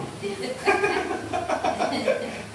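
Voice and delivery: unvoiced high chuckle; airy laugh. Real, not acted. Preacher laughing after mocking accusers, airy chuckle. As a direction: laugh breathily at the joke.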